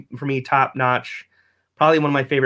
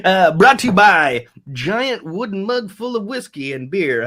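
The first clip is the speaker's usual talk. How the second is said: slow, higher, louder mock ad announcement; mock announcer plugging a whiskey mug; mock sponsor read; 5 semitones higher, 3 dB louder, slower than usual. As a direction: hype a fake sponsor like a radio ad. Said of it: "This is a good time for a sponsor break".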